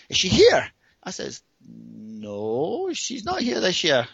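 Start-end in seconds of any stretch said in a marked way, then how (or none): 0.1-0.7 high, loud quick question; imitated pointed challenge. Tipsy woman challenging his girlfriend excuse, imitated high pointed question. 1.6-4.1 long drawn-out no gliding upward; reluctant admission. Retold or imitated. Cornered man admitting his girlfriend is away, retold slow reluctance.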